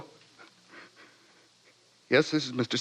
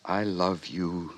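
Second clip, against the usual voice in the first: tender confession; 6 semitones lower, 3 dB quieter than usual. Acted. John confesses love.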